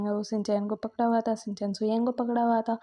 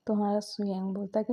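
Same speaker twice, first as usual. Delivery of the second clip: ordinary delivery, nothing marked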